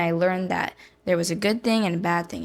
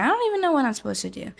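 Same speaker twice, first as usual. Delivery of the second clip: higher, fast and frantic; panicked rush; 7 semitones higher, 3 dB louder, faster than usual. Retold or imitated. Retelling a panic attack to friends, fast frantic voice. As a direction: spill it out in a helpless panic. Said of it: "I was like".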